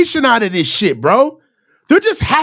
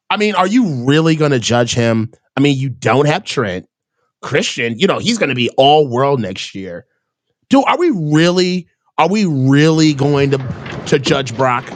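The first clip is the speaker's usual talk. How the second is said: mock reporter; 12 semitones lower than usual. Mocking reporter defending Brock.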